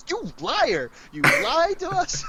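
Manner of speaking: high, loud playful accusation; mock outrage. Teasing a fellow roleplayer about her lie, mock-outraged yelling. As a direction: yell it out in fake betrayal.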